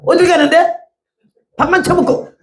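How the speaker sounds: 1 indignant retort. Retold or imitated. Retelling scolding the freeloading man.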